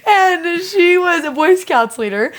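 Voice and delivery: holding back tears as the story gets to her; real crying breaking through the words; choked up. Real, not acted. Retelling a tender story, tearing up.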